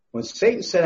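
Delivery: ordinary delivery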